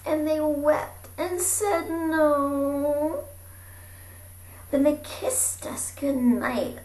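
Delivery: ordinary delivery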